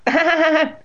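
high, loud acted giggle; high mocking laugh. A narrator's reading. Girl laughs at startled boy, high acted laugh. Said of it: "The girl laughed".

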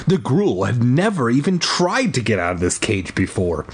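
plain reading voice

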